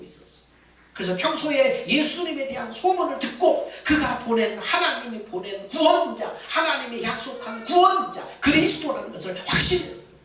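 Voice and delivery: loud, high-pitched sermon delivery; fervent preacher in full flight; impassioned preaching. Acted.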